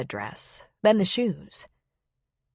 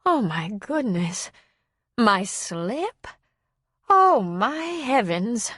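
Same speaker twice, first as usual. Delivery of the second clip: put-on slow, embarrassed elderly lady; mortified old woman, pitch lifting on the last exclamation; flustered old woman; 3 semitones higher, slower than usual. A narrator's reading. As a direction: draw out each dismayed exclamation like an old lady. Old woman mortified about her slip.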